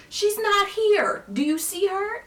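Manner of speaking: high, loud, exasperated retort; annoyed yelling back. Retold or imitated. Annoyed coworker answering the screaming guard, yelling back. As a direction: imitate an irritated shouted reply.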